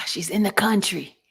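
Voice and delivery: whispered tease, echoing her; hushed teasing repeat; mock whisper. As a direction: breathe it like a secret joke. Mocking friend's excuse.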